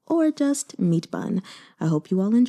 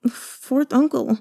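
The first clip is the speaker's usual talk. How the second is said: dazed, tearful; 5 semitones higher, slower than usual. Acted. Dazed nephew after uncle leaves.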